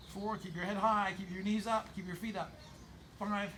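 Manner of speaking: normal talking voice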